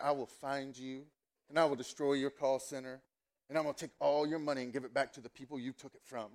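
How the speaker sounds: low, quiet, controlled menace; soft, deadly-serious movie vow; Taken-style threat. Acted. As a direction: play the avenging action hero, voice dropped. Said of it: "go full Taken on these people".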